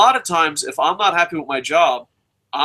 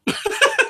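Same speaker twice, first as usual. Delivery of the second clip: high squeal of laughter. Real, not acted. Laughs at his own story.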